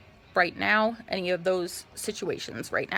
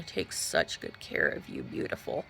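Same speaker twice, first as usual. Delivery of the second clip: hushed imitation of a sleazy doctor's whisper; creepy whisper; 7 dB quieter than usual. Doctor whispering to his patient, imitated creepy whisper. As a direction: breathe it close and creepy, like the doctor did.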